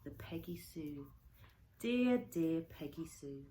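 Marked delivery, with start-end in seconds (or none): none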